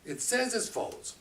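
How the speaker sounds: normal talking voice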